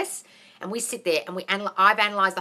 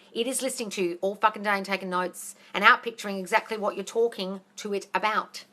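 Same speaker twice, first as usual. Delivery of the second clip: ordinary talk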